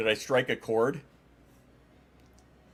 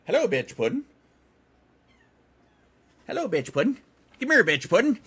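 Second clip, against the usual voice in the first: high, loud sing-song to the cat; 12 semitones higher, 6 dB louder than usual. Real, not acted. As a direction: coo at the pet in a squeaky voice. Baby talk to her cat.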